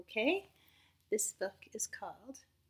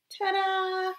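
higher ta-da flourish; excited reveal; 11 semitones higher, 8 dB louder than usual. Acted. Teacher revealing Norman's wings, excited. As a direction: present the wings brightly.

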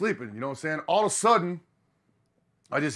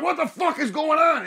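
high, louder alarmed question; 12 semitones higher, 5 dB louder than usual. Retold or imitated. Band finding the driver wandering, high alarmed call. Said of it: "We're like".

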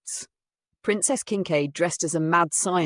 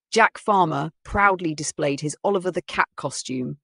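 ordinary delivery, nothing marked

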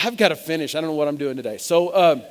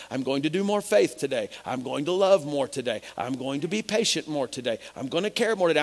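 mock self-resolve; 2 semitones lower than usual. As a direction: parody someone dutifully willing themselves to improve. Voices someone straining to be good.

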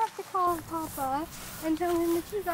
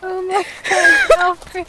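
loud, slow exasperated cry; exasperated shout; 2 semitones higher, 14 dB louder, much slower than usual. Real, not acted. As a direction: yell it over the music. Reacting to loud music.

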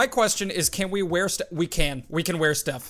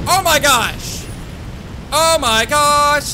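higher, louder oh my gosh, the second drawn out; excited exclaim; 8 semitones higher, 7 dB louder, much slower than usual. Real, not acted. Gamer watches the tornado wreck Goatville, loud amazed exclaim.

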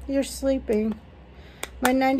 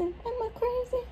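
squeaky high whisper; 13 semitones higher, 8 dB quieter than usual. Real, not acted. Repeats, far higher and softer.

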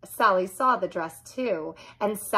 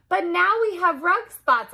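yell; 10 semitones higher than usual. A narrator's reading. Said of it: "I yelled".

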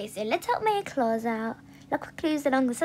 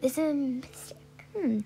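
ordinary talk

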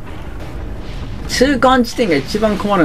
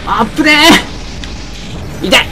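excited yelling; 12 semitones higher, much slower than usual. Real, not acted. Streamer to viewers during a close fight, excited yelling. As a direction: yell out as the battle swings wildly. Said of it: "声結構張り上げちゃった".

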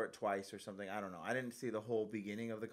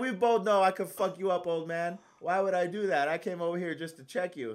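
loud warning; 7 semitones higher, 7 dB louder than usual. Retold or imitated. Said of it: "I said".

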